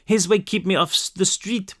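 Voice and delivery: put-on high, distressed French-accented girl; frightened French child, pitched high; broken English, French accent. A narrator's reading. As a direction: stumble through French-accented English, pleading. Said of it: "she said in broken English".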